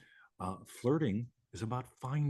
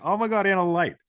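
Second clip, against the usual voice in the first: loud, higher, slow admiring gush; delighted gushing; 7 semitones higher, 10 dB louder than usual. Real, not acted. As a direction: gush over her radiance. Delighted at viewer turning on camera.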